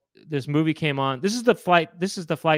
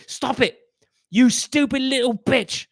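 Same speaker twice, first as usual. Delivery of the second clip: much higher, slow put-on sneering voice; nasty passenger; 11 semitones higher, slower than usual. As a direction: sneer at the little girl in a high voice. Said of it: "he was like".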